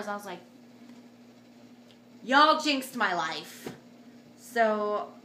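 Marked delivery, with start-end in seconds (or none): 2.2-3.7 loud, slow retold blame; mock accusation. Woman blaming everyone for missed epidural, slow mock accusation. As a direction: draw out the accusation loudly.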